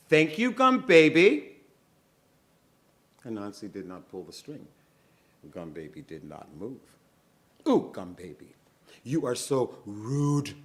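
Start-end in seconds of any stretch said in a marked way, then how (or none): 0.1-1.6 high, loud thank you; loud demand. Acted. Moesha demanding a reply, loudly. Moesha insists a third time. 7.7-10.5 scolding. Acted. Moesha scolds the doll.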